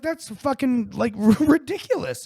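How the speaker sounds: high and loud, exasperated through a laugh; outraged. Retold or imitated. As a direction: let the outrage spill over. Retold outrage at strike.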